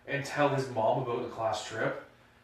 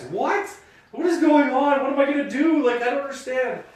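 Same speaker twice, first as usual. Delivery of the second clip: high, loud panicked confusion; mock panic; 13 semitones higher, 10 dB louder than usual. Driver panicking at the cop's orders, loud mock panic. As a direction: act bewildered and scared.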